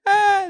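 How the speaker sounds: high loud mock moan; high, loud mock turtle moan. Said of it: "the turtle goes".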